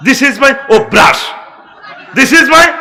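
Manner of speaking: slow, loud mock English boast; hammed-up proud English, slowly; mock interviewee. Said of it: "interview জিজ্ঞেস করে".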